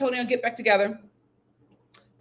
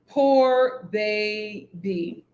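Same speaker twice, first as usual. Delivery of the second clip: slow pitying drawl; much slower than usual. Real, not acted. Pitying Raya, dragged out slowly.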